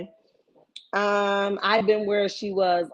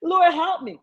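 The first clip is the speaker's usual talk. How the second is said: high, pleading cry to the Lord, half shouted; desperate prayer; 8 semitones higher, 4 dB louder than usual. Retold or imitated. Mocking a woman begging the Lord for help, high pleading. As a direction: beg God for a way out, voice up high. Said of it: "you still want him to be like".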